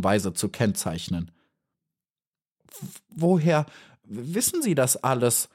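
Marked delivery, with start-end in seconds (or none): 2.7-5.5 a stammer, pitched higher, horrified; stammering in horror. A narrator's reading. Little bee horrified by the tale, stammering. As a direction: stumble on the first word in shock. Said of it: "stotterte Maya aufs Äußerste entsetzt".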